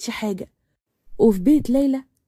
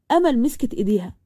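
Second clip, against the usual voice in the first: ordinary delivery, nothing marked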